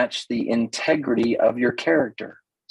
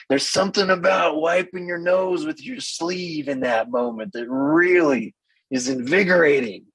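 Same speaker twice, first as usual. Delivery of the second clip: higher, dreamy mock-gushing voice; gushing outdoorsy woman; 5 semitones higher than usual. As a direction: play up her delight in mud and sweat. Imitating outdoorsy women, "they're like.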